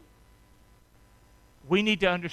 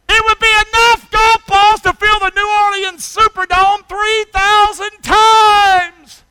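loud and high, dragging out the last words slowly; full-force preaching; 13 semitones higher, 13 dB louder, slower than usual. Acted. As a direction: hammer home how huge it is.

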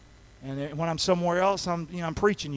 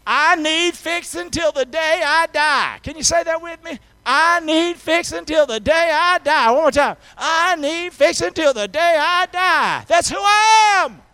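slow, high, shouted preacher's chant; rousing declaration; 9 semitones higher, 9 dB louder than usual. Real, not acted. Preacher has congregation say it with him, shouting rousingly. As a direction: belt it out for the crowd to repeat.

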